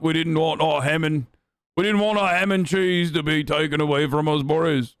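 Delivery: aggrieved, hammed-up British whinge; mock British accent. British voter complaining to Boris about sandwiches, exaggerated British accent. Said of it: "Your accent doesn't correlate with any area".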